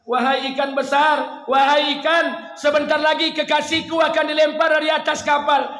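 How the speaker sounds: loud, high commanding call to the fish; commanding divine voice. Acted. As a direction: proclaim it like a mighty king. God commanding the great fish. (suara perintah yang lantang dan tinggi)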